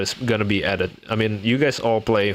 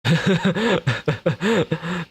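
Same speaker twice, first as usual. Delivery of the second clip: laugh; 9 semitones higher than usual. Real, not acted. Laughing at chat comment.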